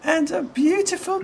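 put-on very high, bright-eyed small boy; high child voice of a small boy with a crutch; little boy voice. GM voicing the boy.

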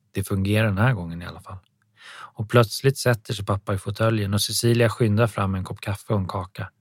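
ordinary delivery

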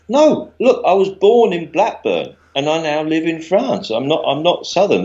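high-pitched, mock-defensive insistence; acted-out indignant denial; pleading protest. Acted protest to a heckling audience.

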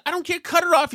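high, sharp, cut them off now; host overruling the producer on the phone, impatient snap. Retold or imitated. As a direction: brush off the excuse angrily.

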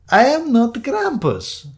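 high, loud, slow protest; exasperated denial. Acted. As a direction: spell it out indignantly. Damp man denies, exasperated.

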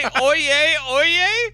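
high, loud mock bailiff cry; court crier call. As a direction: call the court to order, hammed up. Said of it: "Did you just say".

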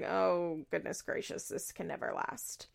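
soft, low, near-whispered doom; mock ominous. Host predicting the romance fails, hushed and ominous. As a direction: murmur it like a grim prophecy. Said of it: "And it's like".

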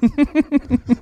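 high, quick giggle; real amused laughter. Real, not acted. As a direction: giggle at the confession. Laughs at the muting remark.

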